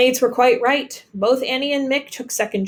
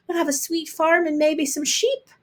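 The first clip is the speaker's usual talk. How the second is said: cooed; 7 semitones higher than usual. A narrator's reading. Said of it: "Ailish cooed".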